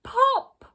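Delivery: sudden high shouted pop; high loud pop. Acted. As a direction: burst it out like a balloon popping. Balloon bursts in story.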